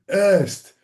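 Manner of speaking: retold shout. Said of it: "someone shouted".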